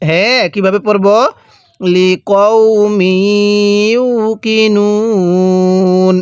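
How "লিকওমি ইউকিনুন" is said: লিকওমি ইউকিনুন with long held vowels; measured model reading of লিকওমি ইউকিনুন; slow tajweed recitation. Shown as a demonstration. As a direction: round the "qow" in লিকওমি, stretch ইউকিনুন. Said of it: "এরকমভাবে শিখব".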